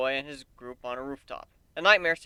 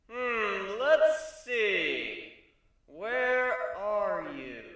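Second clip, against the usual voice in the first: theatrical searching; 6 semitones higher, much slower than usual. A narrator's reading. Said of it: "I act the part of searching for drama".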